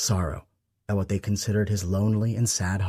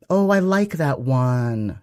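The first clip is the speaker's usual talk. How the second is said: mom's voice; 6 semitones higher, 5 dB louder, much slower than usual. A narrator's reading. Mom comments on his ornament.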